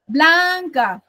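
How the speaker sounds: "Blanca" drawn out, first syllable held long; stress on BLAN. Shown as a demonstration. Teacher scanning an iambic line, stressing the start of "Blanca". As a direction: stretch the BLAN of "Blanca", then let "ca" fall. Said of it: "Suena más fuerte la segunda".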